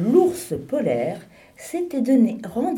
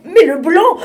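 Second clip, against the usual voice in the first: higher and louder, exasperated repeat; more annoyed; 11 semitones higher, 12 dB louder than usual. Acted. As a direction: push the repeat up in frustration. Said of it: "qui commençait à s'énerver".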